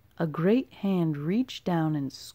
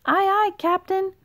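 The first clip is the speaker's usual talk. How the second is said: toadlets' cheer; 13 semitones higher, 6 dB louder than usual. A narrator's reading. Toadlets answer in chorus.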